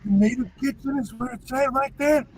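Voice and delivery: lightly voiced Italian mobster accent, hushed; half-joking wise-guy Italian accent; mock Italian gangster. Host teasing co-host about Italian cooking in mock Italian gangster accent. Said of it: "Yeah, jokes".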